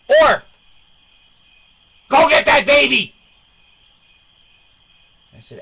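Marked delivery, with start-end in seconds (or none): 2.1-3.1 higher, loud command; stern order. Retold or imitated. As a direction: order her out the door. Dad ordering daughter.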